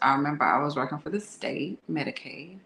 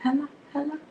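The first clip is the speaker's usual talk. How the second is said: higher, calling hello down the line; girl on the phone; 11 semitones higher, faster than usual. Retold or imitated. Girl on his phone answering, calling hello. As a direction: imitate a woman calling out on the phone. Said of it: "And she was like".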